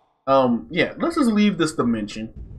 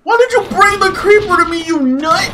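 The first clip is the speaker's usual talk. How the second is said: high, loud screamed protest; panicked outrage; 14 semitones higher, 6 dB louder than usual. Real, not acted. Player to dragon bringing creeper over, outraged screaming. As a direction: scream at a pet's blunder.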